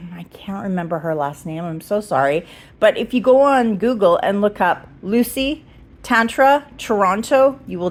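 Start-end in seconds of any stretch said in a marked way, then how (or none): 0.1-2.5 whispering. Real, not acted. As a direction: whisper it apologetically. Aside admitting she forgot.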